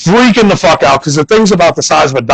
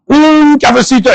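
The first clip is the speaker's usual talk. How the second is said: Spanish attempt; 9 semitones higher than usual. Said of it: "I did exactly that".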